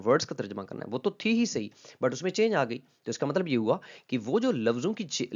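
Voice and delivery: ordinary delivery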